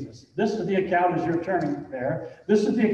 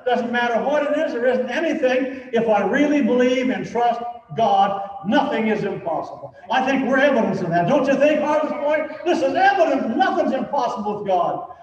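rising, louder preacher's build; preaching at full force; 5 semitones higher, 3 dB louder than usual. Acted. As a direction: preach it up, climbing higher and louder.

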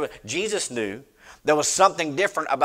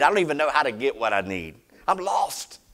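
ordinary talk

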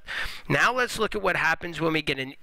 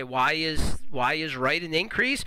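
normal talking voice

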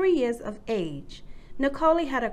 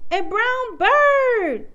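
loud, high, drawn-out delight; excited exclamation; 9 semitones higher, 8 dB louder, much slower than usual. A narrator's reading. Susie spots a toy in the backyard, excited. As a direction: stretch it out in happy discovery. Child's delighted find in story.

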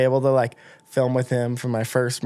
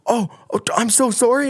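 high, flustered mock apology; imitating his own sheepish backpedal; embarrassed apology; 11 semitones higher than usual. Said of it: "And I was like".

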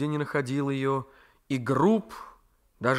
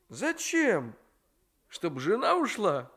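a nephew daring fate to strike; defiant young man; 6 semitones higher, slower than usual. Acted. Raisky mocking.

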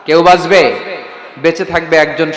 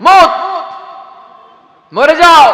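loud, high shouted "die"; shouted command; 9 semitones higher, 4 dB louder, slower than usual. Acted. Preacher voicing the angel of death, loud shout. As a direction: bark the command to die at full voice.

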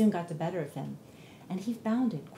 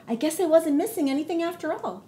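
content bunny voice; 7 semitones higher, 5 dB louder than usual. Acted. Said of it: "he said to himself".

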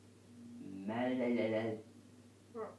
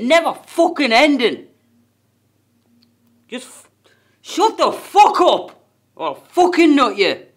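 screaming at full force, much higher and louder; furious screaming rant; 10 semitones higher, 21 dB louder than usual. Real, not acted. Venting months of frustration at the world, furious screaming. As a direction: tear your throat open, raging at everyone. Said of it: "I just need to shout".

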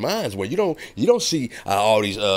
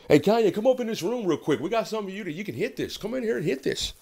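high, fast, hushed beckoning; sly tempter; 11 semitones higher than usual. Acted. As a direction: lure him into the room. Mock tempting [name] into drugs.

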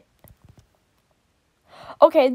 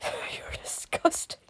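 laughing whisper; 12 dB quieter than usual. Real, not acted. Laughing at fan art.

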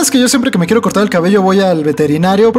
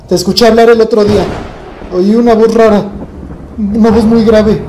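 louder, pressing accusation; insistent accusing; 3 semitones higher, 4 dB louder than usual. Real, not acted. Vlogger confronting his dog, loud and insistent. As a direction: push the dog to confess, louder.

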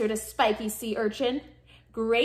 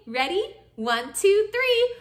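plain reading voice

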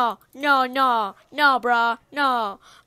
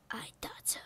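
whispering; 2 semitones lower, 15 dB quieter than usual. Acted. Aside after joke.

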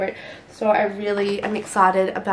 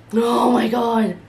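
excited; 2 semitones higher, 4 dB louder, slower than usual. Real, not acted. Raving about her favourite.

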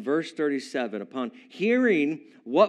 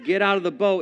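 ordinary talk, nothing marked

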